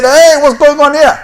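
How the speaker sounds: high, loud "Hey, what's going on here?"; mock alarmed outcry. Governments see the newcomers' big families, call out in mock alarm. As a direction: play the governments crying out in alarm.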